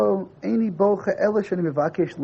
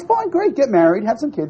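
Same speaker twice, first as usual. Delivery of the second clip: high, loud, breezy brush-off; tossing off the advice as if it were nothing; mock eager father; 6 semitones higher, 6 dB louder than usual. Teacher imitating the father waving off his son, high and loud.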